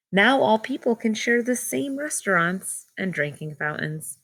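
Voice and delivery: clear, measured read-aloud storybook voice; reading to children. Acted. Teacher reads picture book aloud.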